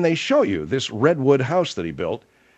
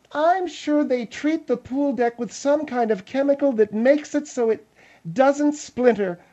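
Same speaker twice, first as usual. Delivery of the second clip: higher, disdainful woman's voice; Margaret; 9 semitones higher, slower than usual. Acted. Margaret calling Phil an oaf, contemptuous.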